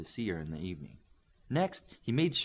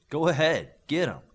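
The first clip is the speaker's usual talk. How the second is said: higher and louder, egging her on; eager go-ahead; 6 semitones higher, 7 dB louder than usual. Acted. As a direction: give the signal to attack. Leader gives the go-ahead.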